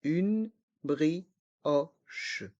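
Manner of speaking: slow "Une brioche", each syllable apart; learner-paced, split "Une brioche". Shown as a demonstration. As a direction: separate each sound of "Une brioche", down to the final che. Teacher breaks phrase into syllables.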